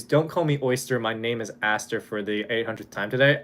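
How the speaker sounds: ordinary talk, nothing marked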